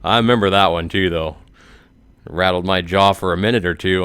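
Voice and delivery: ordinary delivery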